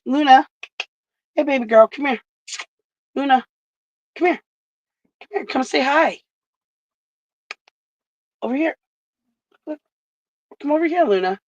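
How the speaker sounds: high coaxing call to the dog. Real, not acted. Calling Luna over to say hi, high coaxing voice. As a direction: coax her over in a high sing-song voice.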